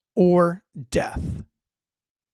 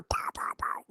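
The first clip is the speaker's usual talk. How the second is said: whispered gunshots; 13 dB quieter than usual. Retold or imitated. Gunshot sounds in woods.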